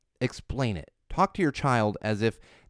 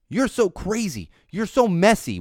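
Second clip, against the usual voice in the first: mock scolding parent; 8 semitones higher, 6 dB louder than usual. Parent scolding child, acted.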